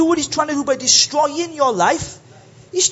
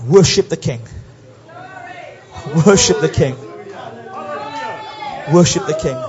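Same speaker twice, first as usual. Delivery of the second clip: worship call; 10 semitones lower than usual. Acted. Preacher leads repeated worship call.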